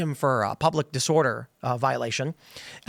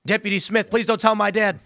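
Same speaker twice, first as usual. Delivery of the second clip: mock pleading, raised pitch; pleading kid; 6 semitones higher than usual. Speeding kid begging Deputy Smith, pleading. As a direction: beg like a busted teenager.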